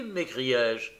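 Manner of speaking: usual speaking voice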